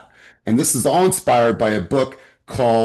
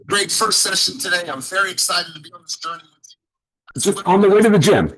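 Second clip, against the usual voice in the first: higher, bright-eyed client video greeting; upbeat video message; 6 semitones higher than usual. Shown as a demonstration. Coach demoing a quick car video to a client, upbeat and higher. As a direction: sound thrilled for a new client. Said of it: "Well, this is me".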